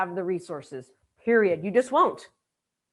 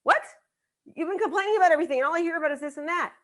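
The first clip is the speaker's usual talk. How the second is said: fast, higher-pitched complaint; incredulous spouse; 5 semitones higher, faster than usual. Acted. Spouse answering after endless complaining, incredulous. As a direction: fire it back in mock exasperation. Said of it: "they're just like".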